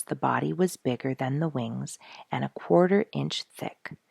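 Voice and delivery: ordinary delivery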